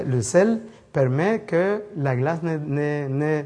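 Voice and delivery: ordinary talk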